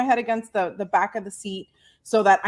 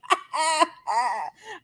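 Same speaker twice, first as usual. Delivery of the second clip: laughter; 7 semitones higher than usual. Real, not acted. Laughing while telling story.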